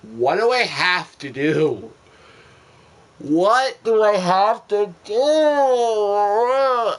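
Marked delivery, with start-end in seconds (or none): 3.2-6.9 much higher and louder, very slow and stretched; a long exasperated shout, every word dragged; drawn-out frustrated yell. Real, not acted. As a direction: howl it at the ceiling. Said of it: "repeated louder".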